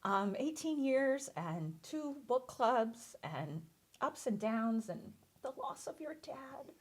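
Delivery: choked up, half whispered; fighting back real tears; tearful. Real, not acted. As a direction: voice breaking into a whisper. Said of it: "I guess I'll stop crying".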